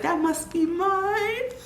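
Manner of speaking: high, slow, amazed, laughing a little; awed new mom. Retold or imitated. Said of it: "I was like".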